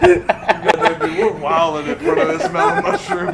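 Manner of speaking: laughing. Real, not acted. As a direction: laugh while saying it.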